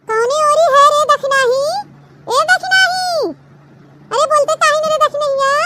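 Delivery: put-on an old village aunt calling Dakshina's name from a distance. Bahira Kaki calls out searching for Dakshina, in a high calling voice.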